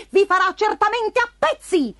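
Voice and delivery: high-pitched cat's warning, pressed home; threatening cat. A narrator's reading. Cat warns field workers of the marquis, high threatening voice. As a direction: warn them like a scheming storybook cat, high voice.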